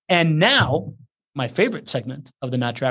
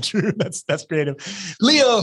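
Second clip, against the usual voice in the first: ordinary delivery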